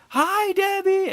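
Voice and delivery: high, slow, loud sing-song crowd greeting; crowd in chorus. Retold or imitated. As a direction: drawl it like a whole crowd answering on cue. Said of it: "the whole crowd's like".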